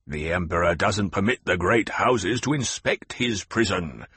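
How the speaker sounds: plain reading voice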